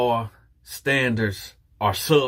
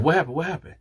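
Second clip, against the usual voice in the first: higher, urgent retold question; alarmed questioning; 6 semitones higher, much faster than usual. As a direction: imitate her anxious double question. She reacts to the drop.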